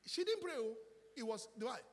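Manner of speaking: excited exclamation. Real, not acted. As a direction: announce the surprise to the church. Preacher exclaims revelation.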